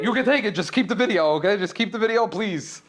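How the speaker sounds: pleading loud and high, keep the video please; frantic plea. Retold or imitated. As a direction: plead like he's grabbing your phone. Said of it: "I was like".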